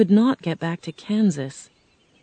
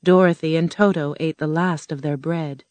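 usual speaking voice

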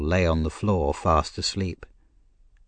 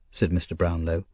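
ordinary delivery, nothing marked

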